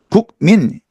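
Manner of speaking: "국민" as written, "guk-min"; spelled-out "국민", no ng; unassimilated pronunciation. Shown as a demonstration. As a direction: keep a k stop in "국민". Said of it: "이렇게 읽지 않고".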